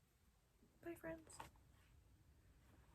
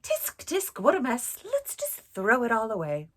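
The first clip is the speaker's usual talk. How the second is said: loud, tut-tutting kangaroo; 11 dB louder than usual. Acted. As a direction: scold the mess like a fussy kangaroo. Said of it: "The kangaroo".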